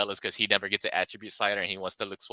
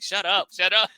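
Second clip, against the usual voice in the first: high, louder mock exasperation; annoyed; 11 semitones higher, 5 dB louder than usual. Retelling Summer fed up with Morty, mock-annoyed outburst. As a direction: snap it like a fed-up sister, playfully. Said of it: "she gets so annoyed".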